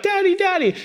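put-on high child's call of "Daddy, Daddy". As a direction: call out like a small boy at the playground. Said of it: "somebody else's son cry out".

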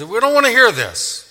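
high, loud mock protest; loudly shutting someone out; stubborn refusal. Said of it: "put their hands over their ears".

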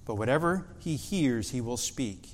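scripture reading. Acted. Pastor reading the Gospel to the congregation, soft and measured. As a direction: read the verses with quiet reverence.

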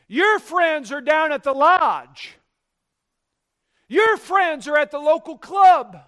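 loud, high-pitched pulpit challenge; forceful preaching. Real, not acted. Preacher challenging churchgoers about where their friends are, loud and forceful. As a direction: drive it home loudly to the congregation.

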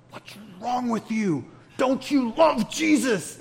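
high, slow, put-on voice of disbelief; incredulous Peter type. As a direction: ask it as if shocked anyone could be calm. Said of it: "And the Peter guy is like".

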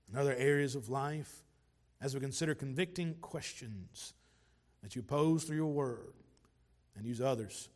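low, soft praying voice; prayer. Real, not acted. Preacher prays for help with convicting questions, low soft prayer.

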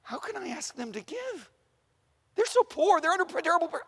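high-pitched, troubled thinking aloud; agonized fretting. Acted. Paul frets to himself about asking the poor to give, high and distressed.

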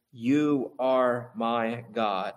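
"You are my God" word by word, very slowly; weighty declaration. Shown as a demonstration. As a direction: give each word of "You are my God" its own space. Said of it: "and then he says".

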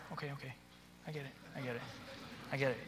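very quiet, low murmurs of understanding; sympathetic listening. Shown as a demonstration. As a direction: softly reassure while they pour it out. Models listening to a kid's story.

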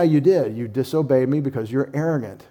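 ordinary talk

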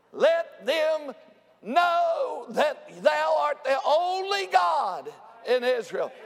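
high, drawn-out preacher's cry; proclaimed prayer. Acted. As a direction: call out to God with slow, rising force. Acts out Elijah's public prayer.

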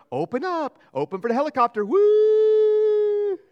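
high, loud sing-song coaxing, ending in a whooping cry; Miss Marisa spoon-feeding. Retold or imitated. Wife spoon-feeding her husband like a baby, high playful coaxing. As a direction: coax a grown man to open wide like a toddler. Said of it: "you can imagine Miss Marisa going".

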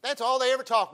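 imitating a huffy new believer; mock complaint. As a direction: play the offended church member. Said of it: "they get so offended".

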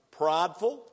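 usual speaking voice

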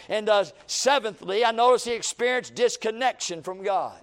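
fervent sermon delivery; preaching. Acted. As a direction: preach it to the congregation.

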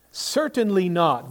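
firm, ringing refusal from the pulpit; emphatic negation. Retold or imitated. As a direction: rule it out forcefully. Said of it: "one of Paul's emphatic, uh, negations".

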